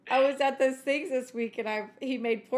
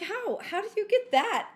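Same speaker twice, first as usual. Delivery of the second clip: much higher, disbelieving question; mock amazed squeal; incredulous; 10 semitones higher, 3 dB louder than usual. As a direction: ask it in utter disbelief. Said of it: "I'm like".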